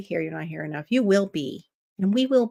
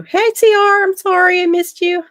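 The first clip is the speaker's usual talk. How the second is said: loud, high excited hello; excited greeting; 12 semitones higher, 10 dB louder than usual. Real, not acted. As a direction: greet a friend loudly and happily. Greeting a viewer in chat.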